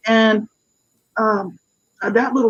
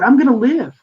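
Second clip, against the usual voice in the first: louder, higher, a resolute vow; firm declaration; 5 semitones higher, 5 dB louder than usual. Retold or imitated. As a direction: say it like a decision finally made. Resolving aloud to live.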